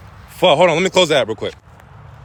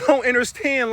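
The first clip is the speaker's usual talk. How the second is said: ordinary delivery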